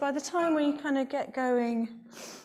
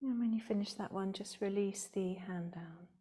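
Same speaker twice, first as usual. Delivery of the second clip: calm, measured yoga teacher voice, softer than chat; guided meditation; 4 semitones lower, 8 dB quieter than usual. Real, not acted. Yoga teacher leading the class through alternate nostril breathing, calm guided meditation. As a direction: guide the breathing with long pauses between phrases.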